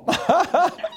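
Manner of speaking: high sheepish giggle; embarrassed laugh. Real, not acted. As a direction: laugh at your own blunder. Laugh at own blunder.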